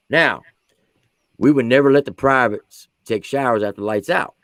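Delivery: ordinary delivery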